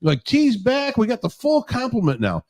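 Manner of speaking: lightly hammed-up cheering pundits; mock cheering. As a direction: imitate happy pregame hosts. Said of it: "They were cheering".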